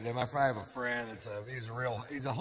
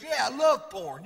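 higher, louder boast; friend's quote; 9 semitones higher, 6 dB louder than usual. Retold or imitated. Homophobic friend boasts about porn, higher and louder. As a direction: brag it out. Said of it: "He goes".

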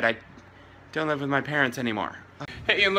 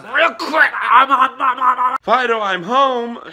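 excited; 8 semitones higher, 8 dB louder than usual. Acted. Skit, excited owner coming home.

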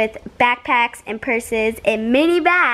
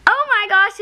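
excited; 8 semitones higher than usual. Real, not acted. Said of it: "I'm so excited".

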